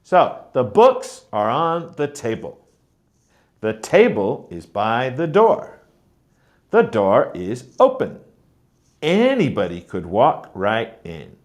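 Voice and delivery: books and table stressed, the rest light; stress on content words. Shown as a demonstration. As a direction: lean on books and table, let the small words slip by. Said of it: "I'm stressing on the words that are important".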